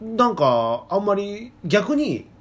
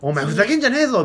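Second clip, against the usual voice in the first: harsh Tokyo retort; 6 semitones higher, 4 dB louder than usual. Retold or imitated. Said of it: "ツッコミすっごいきつく聞こえてた".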